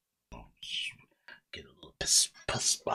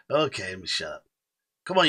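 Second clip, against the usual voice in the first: normal talking voice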